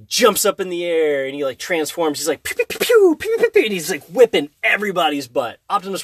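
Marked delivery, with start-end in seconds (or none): 2.4-3.6 high mock laser blasts; childlike pew pew noises, higher; laser pew sounds. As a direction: fire off pretend laser shots. Imitates laser shots.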